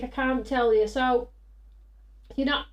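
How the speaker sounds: ordinary talk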